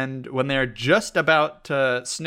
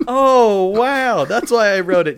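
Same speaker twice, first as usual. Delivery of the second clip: loud, high delight; bursting with glee; excited; 9 semitones higher, 11 dB louder, slower than usual. Real, not acted. Still thrilled about the topic.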